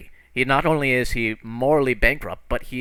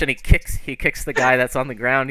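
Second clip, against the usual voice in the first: ordinary talk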